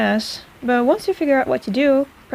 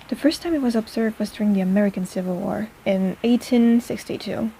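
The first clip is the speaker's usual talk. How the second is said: normal talking voice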